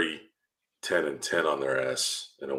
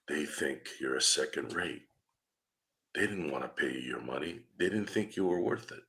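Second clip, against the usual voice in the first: pretend whisper in the ear; 7 dB quieter than usual. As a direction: speak softly, as if privately into his ear. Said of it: "I'm just whispering in his ear".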